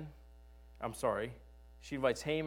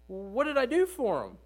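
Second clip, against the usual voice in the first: higher, puzzled king asking aloud; surprised king; 9 semitones higher, 4 dB louder than usual. Retold or imitated. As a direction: play the startled king, pitched up. Preacher voices the surprised king.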